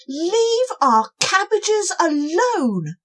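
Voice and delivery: high, loud and slow; shouted. Acted. Cobwobblies shouting at the fierce snail. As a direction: yell it to protect the cabbages. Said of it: "they shouted".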